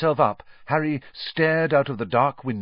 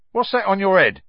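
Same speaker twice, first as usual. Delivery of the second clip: high, loud sudden question; abrupt Cockney conductor; 12 semitones higher, 9 dB louder than usual. Acted. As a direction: blurt it out, nosy. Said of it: "said Stan abruptly".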